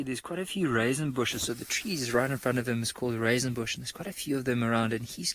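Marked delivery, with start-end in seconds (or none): none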